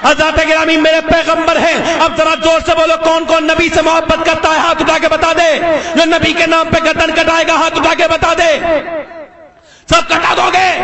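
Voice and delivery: steady, projected sermon narration; insistent pulpit delivery; forceful preaching. Real, not acted. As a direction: tell it loudly to a whole congregation.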